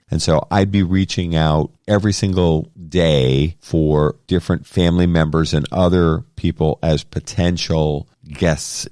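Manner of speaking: ordinary delivery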